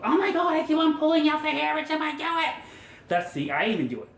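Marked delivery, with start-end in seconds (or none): 0.0-2.5 high, laughing frustrated whine; mock exasperated beginner. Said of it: "don't get frustrated in the beginning going".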